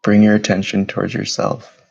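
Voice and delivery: steady, even meditation voice with long pauses; measured, level-toned meditation leader, unhurried between breaths; guided meditation. Acted. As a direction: guide them gently inward, phrase by phrase. Leads guided meditation after bell.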